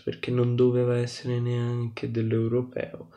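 ordinary delivery

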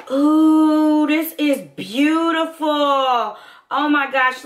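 loud, high ooh with a slow oh my gosh; gushing. Real, not acted. To viewers, swooning over the eyeshadow, loud and high.

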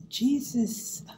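ordinary talk, nothing marked

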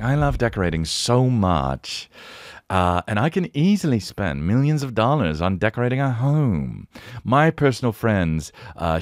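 affected gushing with swooping pitch; decorator impression. Mimicking a guy on a decorating show, affected gushing. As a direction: imitate a self-important TV decorator. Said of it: "And he talks like this".

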